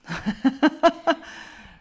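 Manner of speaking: high, loud cackling laugh; hearty laugh. Real, not acted. Interviewee amused at villagers there "bien avant moi", hearty laugh. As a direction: burst out laughing. Laughs at her own remark.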